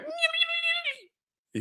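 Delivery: a very high, thin squeal like a dragon's cry; dragon screech. Retold or imitated. Dragon Caraxes answering Daemon with a very high squeal. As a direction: squeal it out shrill and tiny like Caraxes. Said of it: "He was like".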